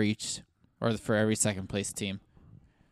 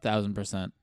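ordinary delivery

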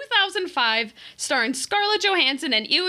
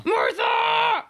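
mock shout; 10 semitones higher than usual. Batman v Superman line shouted.

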